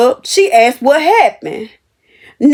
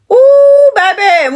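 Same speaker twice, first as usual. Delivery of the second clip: loud ooh; 9 semitones higher, 5 dB louder, much slower than usual. Real, not acted. Telling viewers how hard his words hit, loud ooh. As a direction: shout an ooh at the memory.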